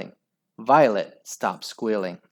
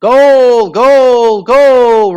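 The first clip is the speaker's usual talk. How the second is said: much higher and louder chanted goal; crowd roar; 11 semitones higher, 16 dB louder, much slower than usual. Acted. Crowd cheering Henry's goal, roaring chant. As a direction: bellow it like a stadium crowd. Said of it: "roared the crowd".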